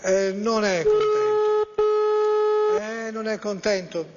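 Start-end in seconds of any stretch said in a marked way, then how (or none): 0.0-2.8 high, drawn-out and theatrical; dramatic high slow. Shown as a demonstration. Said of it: "Io sento le venature nella voce delle persone".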